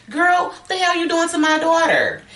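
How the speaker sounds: high-pitched, alarmed acted yell; outraged father. Playing Norma's dad finding Mary choking his daughter, outraged. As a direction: act out an indignant outburst.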